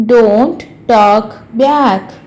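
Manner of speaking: very slow, word-by-word teaching voice; slow repeat. Shown as a demonstration. Teacher repeats a parent's rebuke for learners, slowly. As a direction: separate each word so learners can follow.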